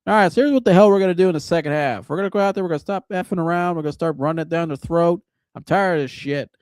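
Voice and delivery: hammed-up coach laying into the team at halftime; fired-up halftime rant. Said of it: "He goes like this".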